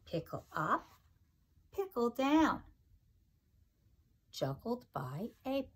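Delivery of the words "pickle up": ordinary delivery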